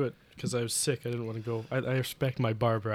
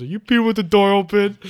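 loud indignant question; 6 semitones higher, 11 dB louder than usual. Retold or imitated. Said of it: "and Josh goes".

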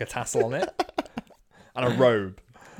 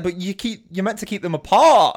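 higher and much louder protest; exasperated outburst; 10 semitones higher, 10 dB louder than usual. Retold or imitated. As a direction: burst out in mock outrage. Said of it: "he's like".

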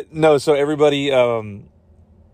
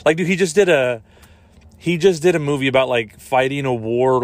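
normal talking voice

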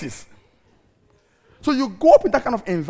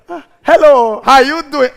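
mocking greeting; 4 semitones higher, 11 dB louder, slower than usual. Mocking the hotel staff greeting.

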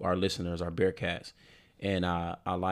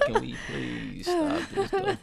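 exasperated plea; slower than usual. Retold or imitated. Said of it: "I'm like".

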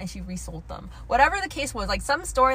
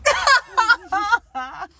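high laugh; 11 semitones higher, 10 dB louder than usual. Real, not acted. Laughs at friend's joke.

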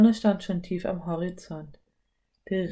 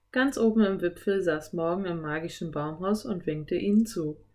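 plain reading voice

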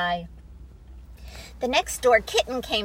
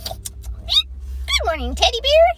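much higher, bright bird voice; chirpy blackbird; 13 semitones higher, 6 dB louder than usual. Acted. Blackbird offers Teddy a worm, high chirpy voice. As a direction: hop in as a cheerful little bird.

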